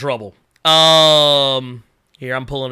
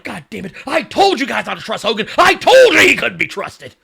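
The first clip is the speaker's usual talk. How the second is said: mock outrage building to a loud, high yell; going off; 4 semitones higher, 4 dB louder than usual. Imitating Bobby the Brain after Hogan turned, going off. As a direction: build to an indignant I-told-you-so. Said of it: "he was going off that night".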